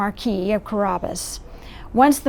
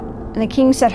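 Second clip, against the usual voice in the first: usual speaking voice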